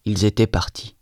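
usual speaking voice

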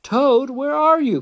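high, loud searching call; high storybook holler. A narrator's reading. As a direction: call high through the house for someone. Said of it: "called Frog".

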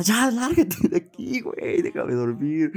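high, slow imitation of an annoyed mother; lightly mock a mom wanting sleep; mamá fastidiada. Voices mom sending him away. (imitación de mamá harta y somnolienta)

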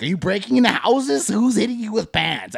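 mock-bewildered interrogation; incredulous questioning. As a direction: demand an explanation in disbelief. Incredulous questioning of coworker's claim.

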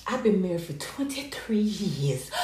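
lightly mock her syrupy brag; mock sugary voice. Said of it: "Sugar brown gossip".

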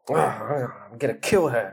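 grumbled threat, kill popping out; muttering. Shown as a demonstration. Teacher acting out Jack's threat for a student, muttered. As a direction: grumble first, then let the threat slip out. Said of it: "muttered Jack".

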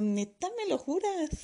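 high, slow disbelieving question; drawn-out disbelief; amazed. Retold or imitated. Amazed patient asks doctor. (asombrada, aguda y lenta)

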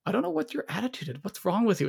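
mock indignant. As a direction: fire off his fake-clueless complaint. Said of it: "part of that whole argument was like".

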